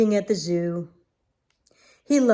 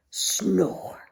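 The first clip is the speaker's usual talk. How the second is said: whispered snore; 3 semitones lower than usual. Acted. Sound effect of snoring.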